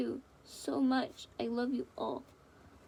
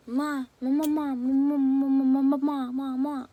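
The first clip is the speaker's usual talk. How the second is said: silly kiss sounds; 2 semitones higher, 11 dB louder than usual. Pretend. I'm just kidding.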